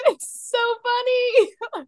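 high, slow words through real laughter; laughing. Real, not acted. Laughing about the episode.

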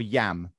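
"yam", low, one syllable with an open vowel; Brummie variant. Shown as a demonstration. Explaining Birmingham speech, "yam" as one word. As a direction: squash "are you" into a low "yam".

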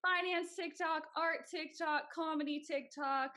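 high, slow emphatic listing; exasperated excited reply. Retold or imitated. Telling millennial friends about TikTok niches, exasperated and high. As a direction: spell it out to a stubborn friend, exasperated. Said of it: "And I'm like".

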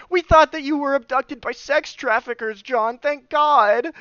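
high-pitched mock-hysterical neighbor; frantic relief. Neighbor who feared he was abducted, frantic relief. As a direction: pour out the worry in a high voice.

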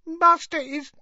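a tipsy elf whimpering about her master; woozy, weepy house-elf; whimpering drunk elf. A narrator's reading. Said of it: "whimpered the elf".

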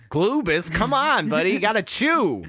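high-pitched playful coaxing; hammed-up exasperated urging; mock pleading.

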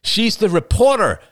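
loud, high cry of discovery; cried out. A narrator's reading. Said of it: "he cried".

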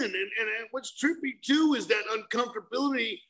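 normal talking voice

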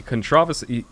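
controversy stressed on TROV, the second syllable; British pronunciation. Retold or imitated. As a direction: imitate the British con-TROV-ersy for controversy. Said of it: "is the enunciation in British English controversy".

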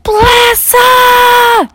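panicked, high, full-force yell; frantic scream. Acted. Mom waking to find kids gone, screaming for husband. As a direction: scream his name in terror. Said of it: "she screamed".

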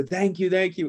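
high-pitched imitation English accent; quick mock thanks in an English accent. Imitating the Englishman thanking him for prayer, English accent. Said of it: "That's my English accent".

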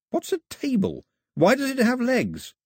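high, wondering questions; puzzled. A narrator's reading. Mao asks about an unknown thing, high and puzzled. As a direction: ask with naive puzzlement, pitched well up.

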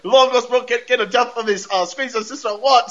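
high, garbled Australian gibberish; mock Australian accent. Podcaster aping the brother's talk, mock Australian accent. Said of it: "He is Australian as a motherfucker".

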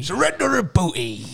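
pirate voice. Pretend. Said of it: "You gotta say it like a pirate".

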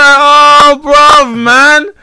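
high, loud disbelieving cry; incredulous mock outburst. Comic reacting to another act's joke, loud disbelief. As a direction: react like you can't believe he said that.

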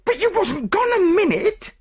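very high, loud, near-tearful snap back; angry retort. Acted. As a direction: fire it back at her. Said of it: "retorted the chambermaid angrily".

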